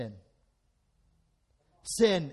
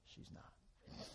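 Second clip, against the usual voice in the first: voiceless laughing whisper; whispered aside; 25 dB quieter than usual. Real, not acted. Preacher whispering the punchline to the congregation. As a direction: breathe it as a secret aside.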